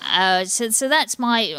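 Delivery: ordinary delivery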